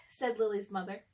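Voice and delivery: ordinary delivery, nothing marked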